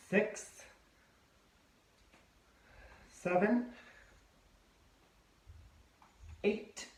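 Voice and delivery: effortful counting mid push-up; straining during push-ups. Real, not acted.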